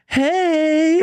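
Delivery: surprisingly high, tiny voice; a big guy with a tiny high voice; mock high-pitched greeting; squeaky high voice. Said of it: "he's like ... sound like Shaquille O'Neal".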